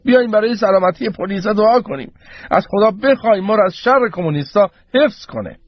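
high-pitched appeal to a crowd; addressing the crowd. A narrator's reading. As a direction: call everyone to join in prayer. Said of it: "رو به جمعیت کرد و گفت". (خطاب به جمعیت، با صدای زیر)

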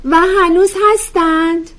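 high, loud, slow character voice; curious questioning. Acted. Curious listener asking the lecturer about matriarchs, high-pitched wonder.

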